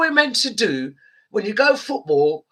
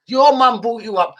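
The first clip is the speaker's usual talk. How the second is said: ordinary delivery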